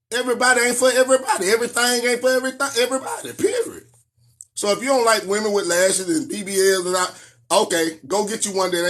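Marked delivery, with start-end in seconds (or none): 0.1-3.8 high, fast, rising to a squeaked period; emphatic punchline. Real, not acted. Lecturing men on live stream, fast emphatic high. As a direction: hammer the point home, climbing.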